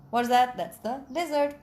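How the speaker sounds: plain reading voice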